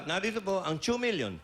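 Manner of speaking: "two" turned into "chu", as quoted; imitated "chu" pronunciation. Commentator repeating the host's prize announcement, "two" mimicked as "chu". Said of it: "Chu pa nga ang pagkakasabi po eh".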